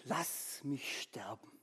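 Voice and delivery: whispered low and slow; weary whisper. A narrator's reading. Elijah under the broom bush begs God to let him die, in a slow, weary whisper. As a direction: breathe out the wish to die. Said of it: "stöhnte er".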